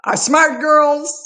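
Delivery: emotional thanks to close friends; tearful. Real, not acted. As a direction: push the words out through tears. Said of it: "this is where I get teary-eyed here".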